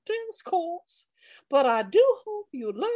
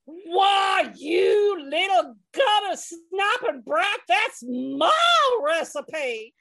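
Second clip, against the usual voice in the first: furious Southern scolding, slow at end; angry shout; much slower than usual. Acted. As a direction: yell at the brat, spitting mad. Kitty scolds brat.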